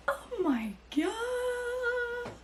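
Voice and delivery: very slow, high drawn-out gasp; real drawn-out emotional cry; overwhelmed. Real, not acted. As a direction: stretch it out, overcome. Overwhelmed by the book.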